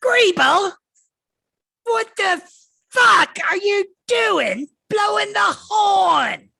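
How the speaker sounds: high shrieking goblin shout, slow and loud; shrill furious goblin scream from the wagon top; goblin yell. Acted. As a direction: screech it at the underling like an angry goblin boss. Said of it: "she yells back and she goes".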